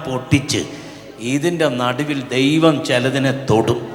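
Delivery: loud, emphatic sermon delivery; forceful preaching. Real, not acted. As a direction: press each phrase home to the congregation.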